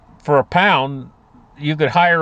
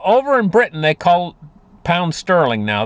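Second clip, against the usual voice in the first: ordinary talk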